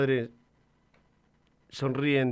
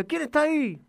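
curious shout; 13 semitones higher than usual. A narrator's reading. Boy calls across the mountain, curious shout. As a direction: call out into the mountains to find who's there. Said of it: "y con curiosidad grita".

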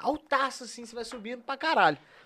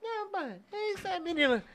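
soft squeaky mock voice; 9 semitones higher, 6 dB quieter than usual. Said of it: "Aí ele".

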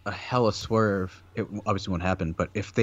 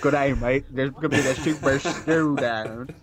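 over-egged Australian accent, played for laughs; 4 semitones higher than usual. Co-host takes a turn at the Australian accent. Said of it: "your Australian accent".

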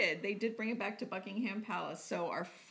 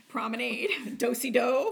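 teasing; 4 semitones higher, 8 dB louder than usual. Pretend. Teasing about dance word.

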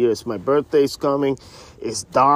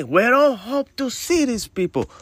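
raised-pitch wishful line; hopeful phrasing; 10 semitones higher than usual. Shown as a demonstration. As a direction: say it high, as a mere hope. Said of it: "We will see these people".

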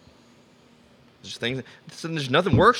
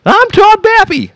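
shouting; 13 dB louder than usual. Pretend. Shouted character intro.

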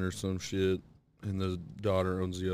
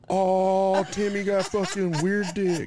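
high, loud schoolkid taunt; drawn-out mocking "oh" into a loud jeer; mock jeering chorus; 11 semitones higher, 6 dB louder, slower than usual. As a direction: crow it out like a teasing classmate. Whole class jeering at a kid.

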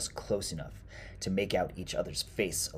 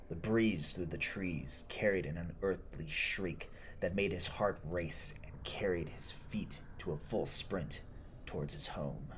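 ordinary delivery

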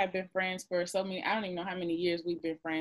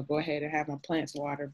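ordinary talk, nothing marked

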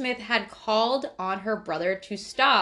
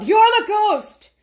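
much higher, louder yelled accusation; mock yell; 12 semitones higher, 11 dB louder than usual. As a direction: shout it out slowly, pointing at him. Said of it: "had yelled".

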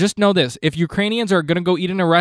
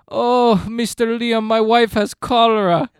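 put-on higher Eastern European accent, slowed; Ukrainian accent; 6 semitones higher, 3 dB louder, slower than usual. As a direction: play the doorman pleading in a mock Eastern European accent. Voicing the Ukrainian guy.

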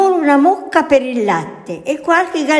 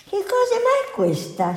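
wondering aloud; 12 semitones higher, 5 dB quieter than usual. A narrator's reading. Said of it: "si chiese".

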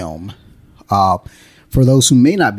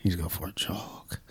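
winded mutter; 3 semitones lower, 12 dB quieter than usual. Retold or imitated. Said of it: "and I'm like".

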